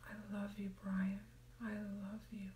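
soft, tender whisper to yourself; loving whisper. Shown as a demonstration. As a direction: whisper it like you mean it. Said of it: "If your name is Brian".

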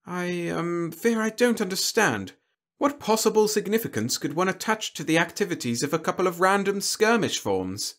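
high, hesitant, bewildered alien; puzzled alien warlord. A narrator's reading. Alien host failing to grasp the proposal, high and puzzled. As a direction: sustain a high voice, politely baffled.

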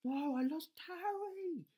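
high, slow wail of loss; a drawn-out, high-pitched groan of despair; anguished cry. Real, not acted. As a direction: mourn the lost troop out loud. Losing a troop in game.

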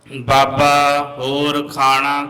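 slow, measured Gurbani chant; scripture recitation. Acted. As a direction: draw out the line endings, pause between phrases.

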